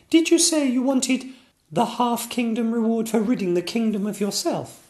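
put-on high childlike princess, apologetic; a small girl sorry to disappoint; little princess voice. Princess Sonia telling the king it won't work, high and childish.

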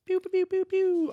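high-pitched laser zaps; laser pew noises. Pretend. Host celebrating the title with high laser zap noises. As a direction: fire off toy laser sounds.

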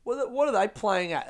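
a touch of retold anger; indignant. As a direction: re-enact baffled irritation, pitch lifted. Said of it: "It made me angry".

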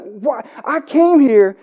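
hammed-up indignant complaint, high in pitch; upset, retold. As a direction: act out the frustrated student confronting his teacher. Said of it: "And he gets so upset".

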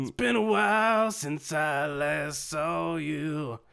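slow, drawn-out villain line; hammed-up, very slow; mock dramatic reunion. Co-host hams up a reunion, very slowly.

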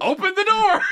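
high, loud hammed-up yell; movie-quote yell. Fan quoting a comedy film, high hammed-up yell. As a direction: yell it like a favorite film line. Acting out a favorite film line.

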